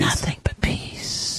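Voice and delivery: slow, low, soft demon whisper; slow deep devil whisper; scary demon voice. Pretend.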